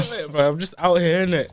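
loud, laughing roadman voice; loud mock roadman. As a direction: shout it like a cocky roadman. Joining the roadman impression.

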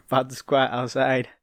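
usual speaking voice

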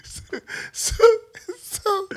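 wheezing, near-silent laugh-crying; so helpless with laughter it turns to tears. Real, not acted. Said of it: "I'm bawling at this point".

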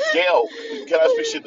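high loud squeaky parody; mock high voice. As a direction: ham it up like a cartoon. Mock exchange voicing characters.

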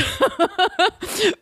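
high-pitched burst of laughter; high, helpless giggle; real laugh. Real, not acted. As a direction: laugh at your own silly story. Laughing at own weirdo story.

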